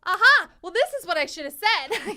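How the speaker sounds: loud, high Aha of realization; belated triumph. Retold or imitated. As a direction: exclaim it like a late comeback. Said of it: "Rose is definitely more of a".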